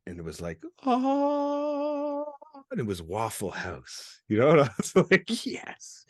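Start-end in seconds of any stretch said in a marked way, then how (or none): 0.8-2.2 long, high sung "ah"; heavenly choir note. Retold or imitated. Imitates heavenly sound on seeing sign. 5.5-6.0 soft whispered yes, acting relieved; whispered triumph. Relief at finding Waffle House.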